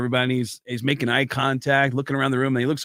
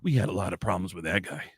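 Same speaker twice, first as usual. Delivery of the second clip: tattoo shop employee; 7 semitones lower, 6 dB quieter than usual. Acted. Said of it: "this is what he hears from the tattoo shop".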